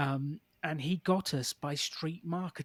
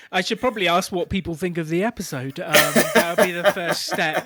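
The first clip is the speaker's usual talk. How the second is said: laughing; 9 dB louder than usual. Real, not acted. Laughs at own joke.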